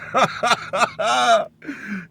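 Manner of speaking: big, high burst of laughter; real laugh at the memory; loud high laugh. Real, not acted. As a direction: crack up remembering it.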